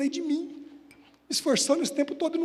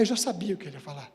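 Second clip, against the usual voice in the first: usual speaking voice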